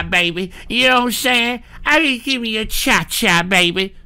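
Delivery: lightly put-on sleazy caller voice; mock a pestering dirty talker; mocking imitation of a woman. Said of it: "just repetitively saying".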